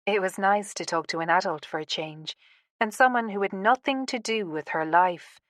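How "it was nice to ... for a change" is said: plain reading voice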